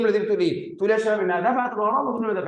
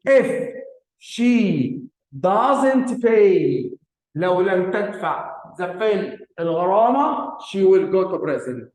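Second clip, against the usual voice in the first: slow read-out; much slower than usual. Shown as a demonstration. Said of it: "فباجي أقول إيه؟".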